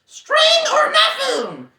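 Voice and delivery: very high, loud, slow breathy shriek; Gollum's desperate shriek. Acted. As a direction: screech it in desperation. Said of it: "shrieked Gollum".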